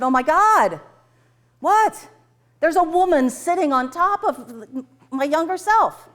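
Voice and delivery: high, loud disbelief; acting out a stunned discovery; shocked alarm. Acted client shocked at what she sees.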